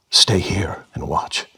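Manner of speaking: slow, heavy-hearted whisper; distressed whisper. Acted. Jesus asking the disciples to keep watch, whispered in distress. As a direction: breathe it out in sorrow. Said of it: "distressed and heavy-hearted".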